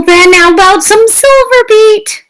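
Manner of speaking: high, eager offering voice; bright high offer of silver beet; chirpy fruit-loving character. Acted. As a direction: pitch it up like a cheery little salesman. Brian offers vegetables.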